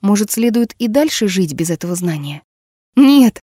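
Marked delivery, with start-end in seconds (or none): 2.9-3.4 loud, high sudden no; blurted no. Acted. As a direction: burst out with it. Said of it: "вырвалось у Неверфелл".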